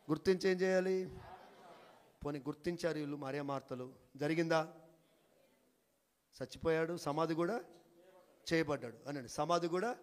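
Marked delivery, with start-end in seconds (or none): none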